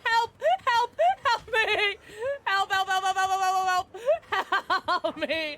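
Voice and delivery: mock cries for help. Streamer chased in a game, hammed-up cries for help. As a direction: yell help over and over in play panic.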